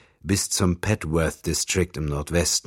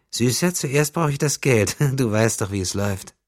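put-on high, louder girlish voice; 8 semitones higher, 4 dB louder than usual. A narrator's reading. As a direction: coo it at him like a teasing young girl. Young prostitute voiced high. (kokette hohe Mädchenstimme)

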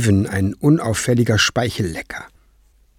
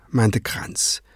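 ordinary delivery

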